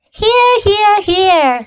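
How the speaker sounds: a goose honking "here" three times; shrill goose. Acted. Goose answers roll call.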